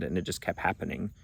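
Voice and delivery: normal talking voice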